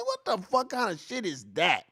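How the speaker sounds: ordinary talk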